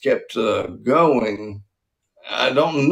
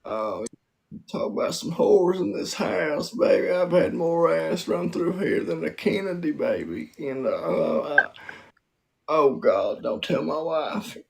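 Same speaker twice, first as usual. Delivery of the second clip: soft, roguish politician impression; a randy ex-president letting slip a secret; Bill Clinton; 4 semitones higher, 4 dB quieter than usual. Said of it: "doing porn as Bill Clinton".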